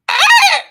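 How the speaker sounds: high shrieking pterodactyl screech; shrill screeching wyvern. Retold or imitated. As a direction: screech like a flying dinosaur. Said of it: "Did you just make a pterodactyl sound?".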